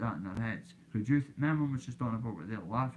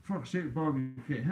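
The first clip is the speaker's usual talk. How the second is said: laughing friend; 5 semitones higher than usual. Retold or imitated. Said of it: "Dougie Joyce went".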